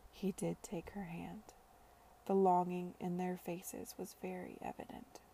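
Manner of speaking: usual speaking voice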